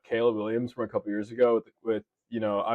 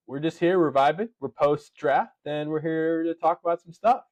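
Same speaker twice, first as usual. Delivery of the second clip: ordinary talk, nothing marked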